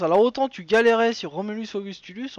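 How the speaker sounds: ordinary talk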